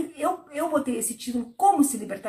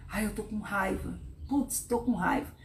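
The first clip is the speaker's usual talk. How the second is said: grumbling to oneself; 4 semitones lower, 8 dB quieter than usual. Pretend. Voicing annoyed inner complaint.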